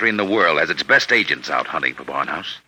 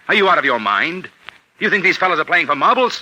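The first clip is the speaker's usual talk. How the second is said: exasperated; 9 semitones higher than usual. Acted. Major losing patience with Clinton.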